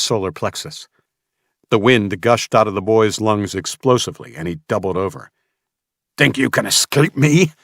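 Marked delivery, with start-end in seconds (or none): 6.2-7.5 loud, higher furious roar. Acted. Mason in Samuel's face, furious roar. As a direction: snarl it in his face. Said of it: "Mason roared".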